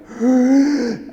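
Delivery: loud, high imitated orangutan gasp; hammed-up animal gasp; ape gasp. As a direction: mimic the waking ape's sharp intake. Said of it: "And so he's going".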